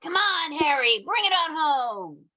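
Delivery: high hopeful cheer. Acted. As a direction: urge him on from the stands. Cheering Harry at bat.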